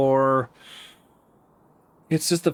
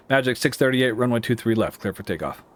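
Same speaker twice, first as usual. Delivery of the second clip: quick, run-together takeoff clearance; too fast. Real, not acted. Controller clearing Magic six thirty-eight for takeoff, too fast. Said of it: "I said it too fast".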